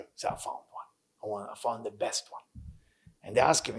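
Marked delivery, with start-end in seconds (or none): 0.3-2.4 unvoiced whisper, very soft and low; hushed secret answer. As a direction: breathe it like a secret only they may hear.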